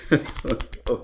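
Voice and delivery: breathy whispered repeat of ingenious; whispered echo. Acted. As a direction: murmur the word back to yourself. Old man echoes the compliment.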